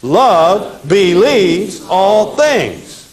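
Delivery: very slow, every word drawn out for the class; slow recitation. Shown as a demonstration. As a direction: chant it slowly so they can repeat. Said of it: "Say it with me".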